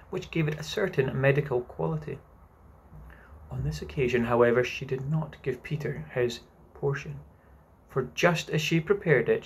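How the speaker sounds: lively storybook narration with voiced characters; animated bedtime reading; reading to children. A narrator's reading. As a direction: read it like a bedtime chapter to a child. Bedtime reading of Peter Pan.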